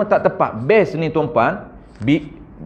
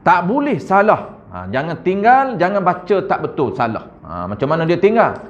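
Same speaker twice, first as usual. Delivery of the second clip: normal talking voice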